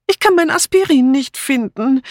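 high, pitiful little complaint; plaintive. A narrator's reading. Dora reappearing without her aspirin, plaintive. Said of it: "erklärte Dora kläglich".

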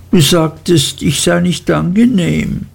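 the hurt little wife scolding her husband; high, childish Dora pleading; childlike young wife. A narrator's reading. Dora's voice voiced by reader.

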